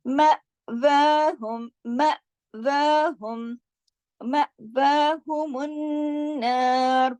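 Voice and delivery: मा व हुम with a catch on म, slow; recitation with glottal catch. Shown as a demonstration. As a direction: break sharply at म. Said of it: "म के ऊपर मैंने झटका दिया". (हमज़ा के झटके के साथ तिलावत)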